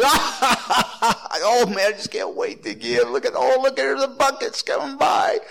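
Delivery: high, giddy mock delight with a laugh; mock eager giver. Pastor mocking a giddy giver watching the buckets, hammed-up glee. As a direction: ham up a giver who can't wait to give.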